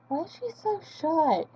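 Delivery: high, lightly voiced puzzled onlooker; puzzled stranger. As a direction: wonder aloud as someone meeting her. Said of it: "if you meet me and you're like".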